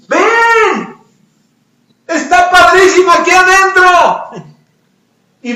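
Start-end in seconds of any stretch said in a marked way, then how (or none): 0.1-4.5 very high, loud beckoning shout; calling out. Acted. Trapped frogs lure the third one in, calling out. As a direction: holler it with hammed-up enthusiasm. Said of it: "Y le gritan".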